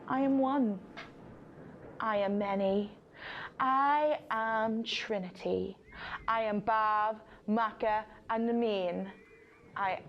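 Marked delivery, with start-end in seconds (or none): none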